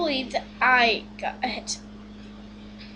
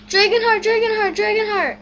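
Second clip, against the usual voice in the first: raised, louder repeated call; 5 semitones higher, 6 dB louder, faster than usual. Acted. Player calling out for Dragonheart, loud repeated call. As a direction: call the name three times, urgently.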